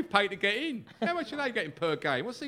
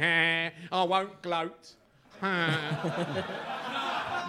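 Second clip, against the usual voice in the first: mock gloating; 4 semitones lower, 3 dB quieter, much slower than usual. Imitating gloating Spurs fans.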